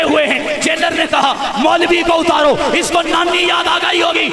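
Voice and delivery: shouted, high, at full force; fiery preaching. Acted. Preacher roaring to the rally crowd, at full force. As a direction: thunder it out to the crowd.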